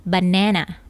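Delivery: American "banana", middle vowel like "neh"; American pronunciation. Shown as a demonstration. Teacher showing how Americans name it, "banana" with "neh". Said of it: "Jika American accent, mereka menyebutkannya dengan banana".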